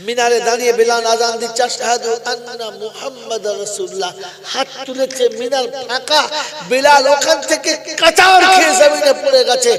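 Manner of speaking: high, loud dramatic narration; impassioned preacher telling of a collapse; full-force preaching. Real, not acted. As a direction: build to a shouted climax.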